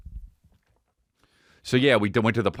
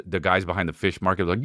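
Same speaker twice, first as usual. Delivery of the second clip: normal talking voice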